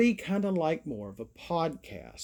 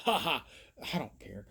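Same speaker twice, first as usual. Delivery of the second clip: ordinary talk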